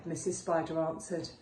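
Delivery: ordinary delivery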